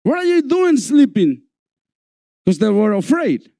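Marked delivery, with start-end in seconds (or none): 0.0-1.4 panicked disciples. Retold or imitated. Disciples calling out to sleeping Jesus in the storm, panicked. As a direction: wake him in alarm, pitched way up. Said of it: "The disciples go to Jesus and say".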